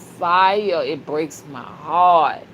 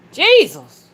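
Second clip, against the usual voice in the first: exclaiming; 10 semitones higher, 8 dB louder than usual. Real, not acted. Overcome with spiritual joy.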